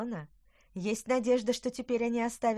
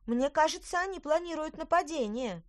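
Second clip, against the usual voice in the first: put-on high little girl, worried; 8 semitones higher, 4 dB louder than usual. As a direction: warn like a young girl. Girl warns of attack.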